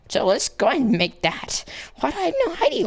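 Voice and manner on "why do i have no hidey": high, fast incredulous question; mock outrage. Player baffled at lacking hidey hole, high mock outrage. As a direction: fire it off high, comically baffled.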